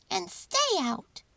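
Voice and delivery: high, slow, squeaky mouse voice; a tiny mouse laying down the law. A narrator's reading. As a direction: mouse ordering the statues away. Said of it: "he said".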